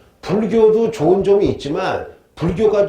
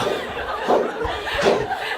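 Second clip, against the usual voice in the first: 참는 웃음; 6 dB quieter than usual. Real, not acted. 과장된 상상에 웃음.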